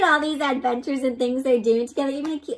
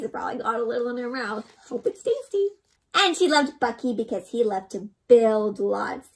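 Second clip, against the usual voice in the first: ordinary delivery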